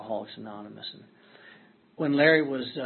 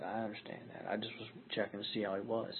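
hushed; 2 semitones lower, 10 dB quieter than usual. Retold or imitated. Hushed at ICU.

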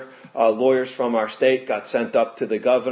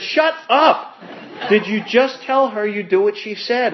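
high, loud shout breaking into laughter; imitating a friend who can't believe it; stunned laughing retort; disbelieving shout; 9 semitones higher, 3 dB louder, slower than usual. Sponsor reacts in disbelief.

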